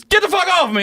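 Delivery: very high, hammed-up protest; high comic outburst; retold outraged yell. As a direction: yelp it like you're shoving someone off. Cousin revived, pushing him away.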